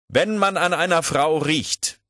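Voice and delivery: high, loud, slow lecturing; insistent lecturer. Acted. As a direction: press on with the lecture, drawn out and emphatic. Puskow doziert beharrlich weiter. (beharrlich dozierend, hoch und laut)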